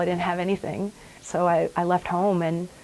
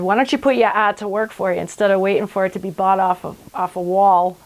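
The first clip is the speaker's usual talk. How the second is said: put-on Boston accent; 6 dB louder than usual. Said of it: "he's got the thick, sort of Revere Boston accent".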